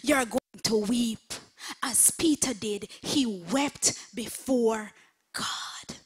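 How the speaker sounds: usual speaking voice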